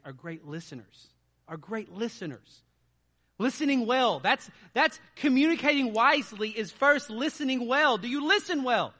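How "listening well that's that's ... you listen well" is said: preaching loud. Real, not acted. Preaching point at full force.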